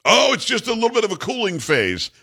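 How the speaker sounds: high, dismissive mock expert voice. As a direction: brush it off like a smug expert. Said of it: "the experts will say".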